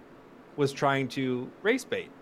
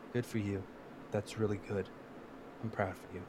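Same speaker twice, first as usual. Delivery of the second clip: much softer, lower patronizing praise; soft, low mock of a fawning host; 4 semitones lower, 13 dB quieter than usual. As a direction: coo it condescendingly. Mocking Colbert's soft praise.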